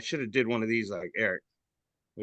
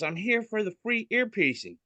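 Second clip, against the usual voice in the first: high, lightly put-on lady's voice; customer voice; 10 semitones higher than usual. Lady in the store asking for piercing, high. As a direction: ask it sweetly like a shop customer. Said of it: "She says".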